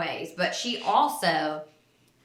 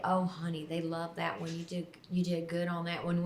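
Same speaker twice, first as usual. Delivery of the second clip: soft praise; 10 dB quieter than usual. Retold or imitated. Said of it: "and she'd say".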